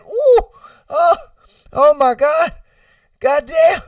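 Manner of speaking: high, loud itch agony; hammed-up yelps of unbearable itching; mock freak-out. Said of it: "freaking the fuck out".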